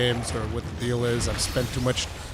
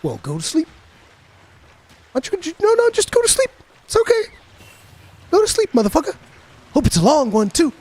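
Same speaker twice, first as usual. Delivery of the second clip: much higher mocking old-man impression; Eddie Murphy; 12 semitones higher, faster than usual. Eddie Murphy mocking Martin Lawrence in a high impression. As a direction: sweetly sneer him to sleep. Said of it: "Eddie Murphy goes like".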